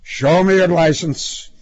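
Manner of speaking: firm, loud police demand; cop's order. A narrator's reading. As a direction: command it forcefully. Cop orders frightened driver.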